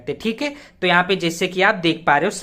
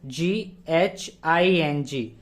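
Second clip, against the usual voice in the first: very slow, each letter of laughing named separately; spelled out letter by letter; 2 semitones lower, 5 dB quieter, much slower than usual. Shown as a demonstration. Teacher spelling laughing for students, very slow and deliberate. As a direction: spell it out slowly for the class. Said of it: "फिर laughing".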